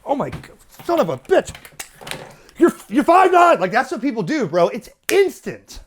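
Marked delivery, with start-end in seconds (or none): 0.0-3.6 much higher, louder hurried reply; mock frantic radio check reply; frantic rush. Shown as a demonstration. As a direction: scramble to answer first. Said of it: "immediately it's like".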